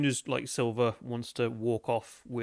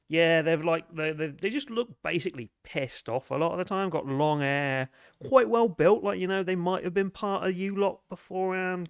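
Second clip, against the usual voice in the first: dancer's higher-pitched, scornful griping; disgruntled dancer; 6 semitones higher, 3 dB louder than usual. Acted. Dancer describing non-tipping customers to investigators, disgruntled. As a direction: describe them like an unimpressed club worker.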